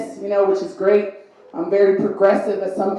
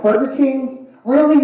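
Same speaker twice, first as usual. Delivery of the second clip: Burger King? Really? pushed higher and louder; incredulous, higher and louder; mock disbelief; 6 semitones higher, 5 dB louder than usual. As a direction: act stunned at the choice. Incredulous reaction to niece.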